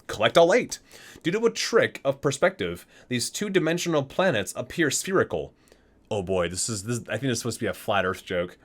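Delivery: ordinary delivery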